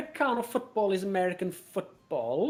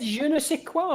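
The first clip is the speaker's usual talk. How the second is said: French phrase; 8 semitones higher than usual. Retold or imitated. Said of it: "he said".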